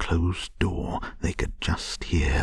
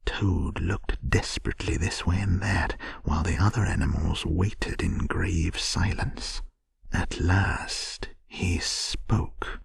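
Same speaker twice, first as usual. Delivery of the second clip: ordinary delivery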